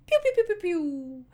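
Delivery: high pew pew gun noises; playful imitated shots; mock gunshots. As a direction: fire off pew pews.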